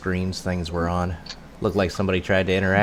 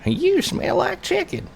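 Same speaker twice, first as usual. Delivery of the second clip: cat voice. Acted. Cat speaks via speak with animals.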